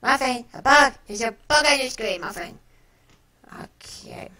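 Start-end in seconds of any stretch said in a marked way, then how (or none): 0.0-2.6 high, loud urgent call. Real, not acted. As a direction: call the cat across the room. Calling the cat about bug.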